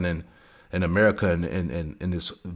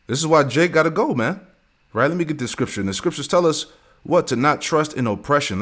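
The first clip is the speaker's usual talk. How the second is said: preaching at full force; 7 semitones higher than usual. Acted. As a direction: preach it up to the congregation.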